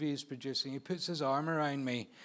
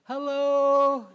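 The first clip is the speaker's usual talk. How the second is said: loud, high, drawn-out mock hello; hammed-up imitation of a director's greeting; 11 semitones higher, 10 dB louder than usual. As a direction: call out an exaggerated hello, pitched way up. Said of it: "the director's like".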